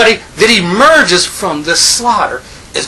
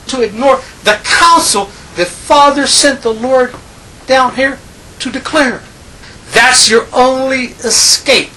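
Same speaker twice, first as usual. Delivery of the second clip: raised, driving sermon voice, pressing each point; fervent preaching; 6 semitones higher, 3 dB louder than usual. Real, not acted. Preacher rousing his congregation with a rising, fervent voice.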